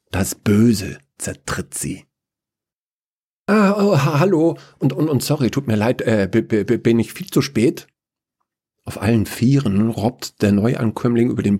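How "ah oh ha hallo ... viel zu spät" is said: higher, stammering apology; flustered latecomer Chaos. Acted. Latecomer apologising to the council, flustered. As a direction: stumble nervously over the words.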